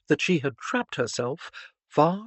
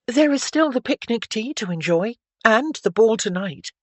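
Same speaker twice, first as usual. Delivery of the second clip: put-on higher, louder girlish voice; consoling, cheerful Stephanie; 7 semitones higher, 6 dB louder than usual. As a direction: cheer him up with lively emphasis. Stephanie's voice in dialogue.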